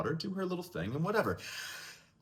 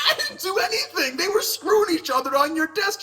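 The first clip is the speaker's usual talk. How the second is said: high, louder laughing outcry; laughing protest; 7 dB louder than usual. Retold or imitated. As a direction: shout your innocence while cracking up. Boy protests, blames classmates.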